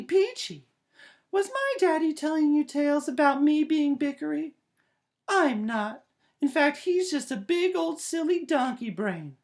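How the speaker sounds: put-on high, loud, squeaky child character; cheeky puppet sassing her daddy; Peachy puppet voice. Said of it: "show you the most bickering person".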